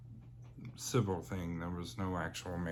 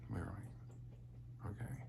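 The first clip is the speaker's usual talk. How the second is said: hushed whisper; 13 dB quieter, slower than usual. Real, not acted. Brother's last wish.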